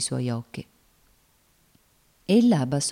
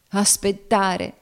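drawn-out, raised outcry; exclaiming; 5 semitones higher, 4 dB louder, much slower than usual. A narrator's reading. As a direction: exclaim it slowly in protest. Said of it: "esclamò Cassie".

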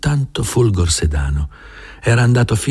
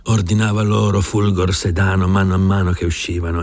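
ordinary delivery, nothing marked

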